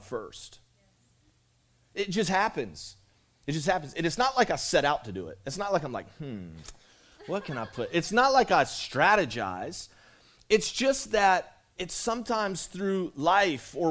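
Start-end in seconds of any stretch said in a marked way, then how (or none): 6.2-7.9 low, hushed musing to himself; mock pondering. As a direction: pretend to mull it over, quietly. Acts out himself scheming.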